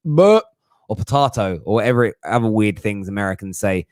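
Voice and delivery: usual speaking voice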